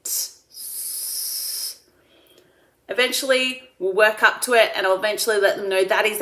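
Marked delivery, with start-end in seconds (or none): 0.0-1.8 t gliding into a clean s hiss; t, then let the air out as s. Shown as a demonstration. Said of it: "and then let the air out".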